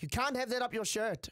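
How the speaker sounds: high-pitched imitation Indian accent. Shop girl scolds the boy hiding something, high Indian accent. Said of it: "I'm gonna have to do the accent".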